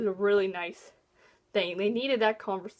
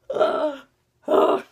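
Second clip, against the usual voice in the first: disgusted groan; 9 dB louder than usual. Real, not acted. Disgust at hated character.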